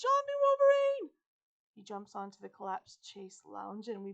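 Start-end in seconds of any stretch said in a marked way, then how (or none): 0.0-1.1 very high, loud repeated alarm yell; panicked shout. A narrator's reading. Boy warns friend of escaped animal, panicked shout. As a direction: yell the warning at full force. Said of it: "Travis shouts".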